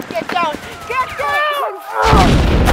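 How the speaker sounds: shouted order. Acted. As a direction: yell get down, loud and urgent. Urgent command in firefight.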